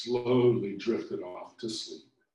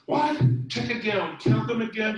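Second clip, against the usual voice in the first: high, loud alarmed shriek; loud high panicked demand; 11 semitones higher, 6 dB louder than usual. A narrator's reading. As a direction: shriek in disbelief. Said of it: "shrieked Lindy".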